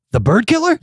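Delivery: high-pitched retold astonishment; incredulous question. Musician retelling hearing Randy Johnson is here, incredulous. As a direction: ask it high, as if amazed.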